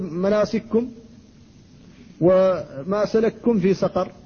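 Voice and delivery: slow reading with kafs merged in مناسككم and سلككم; as Al-Susi reads. Shown as a demonstration. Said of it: "يقول". (قراءة السوسي بإدغام الكاف)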